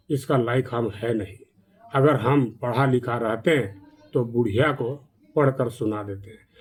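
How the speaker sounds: sorrowful. A narrator's reading. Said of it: "अफसोस करके रोने लगा".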